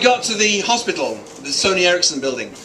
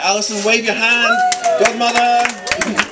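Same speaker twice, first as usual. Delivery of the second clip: higher, stretched call to a guest; 5 semitones higher than usual. Real, not acted. Speaker calls to godmother in the crowd, calling out. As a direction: call across the room, drawn out at the end. Calling to someone across room.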